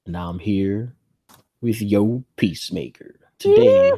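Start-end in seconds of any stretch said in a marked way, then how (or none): 3.4-3.9 sudden high, loud squeak on Today; squeaky word. Acted. Host breaking into a silly high squeak while introducing the show.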